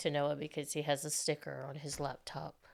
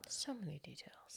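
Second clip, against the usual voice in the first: unvoiced whispered aside; 15 dB quieter than usual. Real, not acted. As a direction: breathe it as a whisper. Whispered aside to the other speaker.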